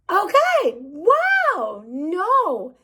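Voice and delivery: high-pitched, drawn-out disbelief; acted taken-aback reaction. Reacting to a man weeping on the floor, exaggerated disbelief.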